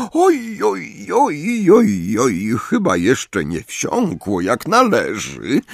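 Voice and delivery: high, sing-song mock sympathy; sugary, high-pitched tutting; smiling teacher. A narrator's reading. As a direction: coo it slowly with a smile in the voice. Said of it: "powiedziała z uśmiechem".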